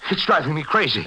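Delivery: high, strained desperation; frantic. Acted. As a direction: crack with panic. Tormented man breaking.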